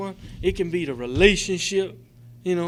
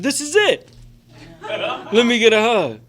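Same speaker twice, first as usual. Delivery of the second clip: high, loud, laughing greeting, slower on the hug; mock friendly biker; 6 semitones higher, 8 dB louder than usual. Biker at the convention greeting newcomer, loud laughing welcome. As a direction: ham up the big friendly biker.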